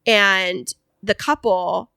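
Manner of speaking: usual speaking voice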